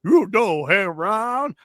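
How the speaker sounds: lightly mocks the co-host's impression style; imitating Johnny. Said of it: "that's Johnny".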